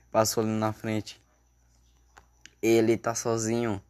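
usual speaking voice

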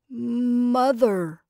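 thick, stifled Scarecrow voice; stifled mumble. A narrator's reading. Scarecrow's first word to its maker, stifled mumble. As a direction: mumble it as if barely able to speak. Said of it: "mumbled the poor stifled voice".

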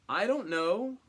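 loud, high-pitched retort; defiant retort. Acted. Cain answering the Lord about his missing brother, defiant retort. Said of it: "Cain retorted".

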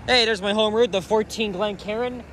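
usual speaking voice

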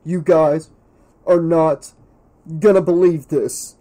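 loud, broken into breathless bursts; gasping, out of breath. A narrator's reading. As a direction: gasp out big news a few words at a time. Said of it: "he gasped, out of breath".

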